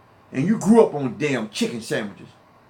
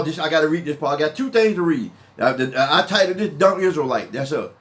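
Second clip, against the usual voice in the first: ordinary talk, nothing marked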